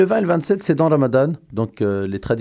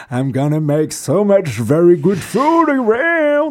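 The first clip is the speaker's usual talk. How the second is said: mock English announcement; 8 semitones higher, 4 dB louder, much slower than usual.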